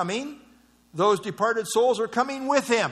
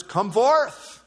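higher, commanding call; voice of authority; 4 semitones higher, 4 dB louder than usual. Acted. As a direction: call it out as a command, imitating Jesus summoning the dead. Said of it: "this is the voice of authority".

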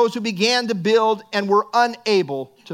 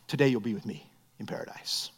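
much lower and softer, tender; quiet gentle reply; 12 semitones lower, 11 dB quieter than usual. Retold or imitated. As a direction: answer softly, reassuring the dying thief. Jesus answering the thief.

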